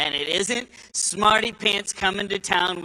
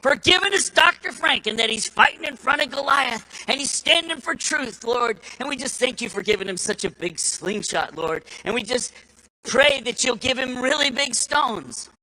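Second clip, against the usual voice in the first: high, loud revival prayer voice; fervent church lady praying aloud; impassioned prayer; 3 semitones higher than usual. Retold or imitated. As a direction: imitate a praying old lady working up a lather. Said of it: "she was getting louder and louder".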